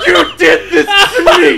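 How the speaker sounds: loud, high drawn-out wail through laughter; hammed-up tearful accusation; mock crying. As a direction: imitate a drunk brother bawling, cracking up as you do. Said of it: "He's on the ground crying".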